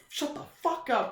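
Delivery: high-pitched retort to a friend; mock irritation. Snapping at friend in story.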